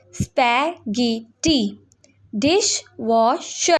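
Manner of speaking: spaghetti and dishwasher said slowly, syllable by syllable. Shown as a demonstration. Teacher sounding out words for students, slowly. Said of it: "learn the correct pronunciation".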